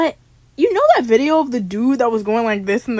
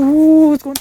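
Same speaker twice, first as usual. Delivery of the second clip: loud, higher mock surprise; startled ooh; 4 semitones higher, 8 dB louder than usual. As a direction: act startled by the touch. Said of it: "I'm like".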